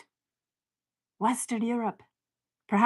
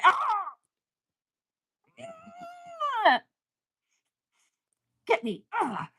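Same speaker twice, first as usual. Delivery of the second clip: choked-up cries; on the verge of tears; slower than usual. Real, not acted. Knowing what's coming, emotional and near tears. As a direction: near tears over the season. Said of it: "I'm about to cry".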